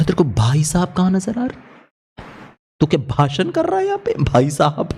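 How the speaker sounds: high, fast incredulous ribbing; mocking scoff. Friend mocks him for calling the other driver sir, scoffing. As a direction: scoff at him for being so polite.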